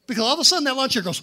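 loud, high rush to the climax; building excitement. Real, not acted. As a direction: race into the big moment. Climax of the accident story.

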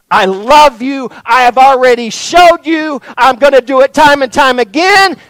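high, loud, insistent assurance; emphatic declaration. Acted. Jesus answering those who doubt his love, loud insistent declaration. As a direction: drive each phrase home at near shout.

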